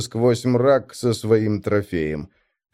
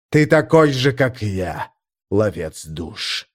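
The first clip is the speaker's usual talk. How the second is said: a throaty growl drawn out at the end; primarch growl; slower than usual. Acted. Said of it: "primarch continues speaking". (медленный рык примарха)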